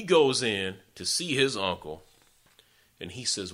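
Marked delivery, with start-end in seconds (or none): none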